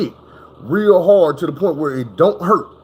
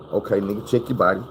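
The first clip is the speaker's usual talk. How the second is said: lower, softer, steady inner voice; calm self-talk; 9 semitones lower, 5 dB quieter than usual. Retold or imitated. After the crash, telling himself calmly, lower and softer. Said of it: "I'm calm and I'm relaxed".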